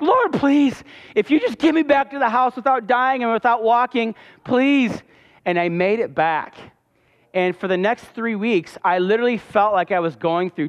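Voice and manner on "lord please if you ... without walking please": high, loud begging prayer; desperate plea. Retold or imitated. Running man begs God out loud, desperate plea. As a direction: beg God to get you home. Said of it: "I'm just shouting down the road".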